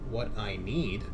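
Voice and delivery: usual speaking voice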